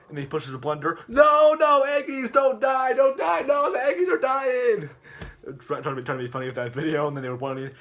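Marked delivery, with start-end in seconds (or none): 1.1-4.9 high, loud, sustained hammed-up scream; mock-anguished wail. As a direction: wail for the doomed eggs at full volume. Acted screaming for dying eggs.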